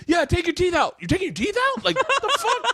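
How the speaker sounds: high, mocking Ari voice; imitating Ari. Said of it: "I can hear Ari go".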